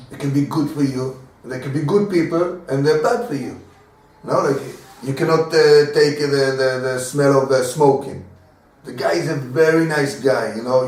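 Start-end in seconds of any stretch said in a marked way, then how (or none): none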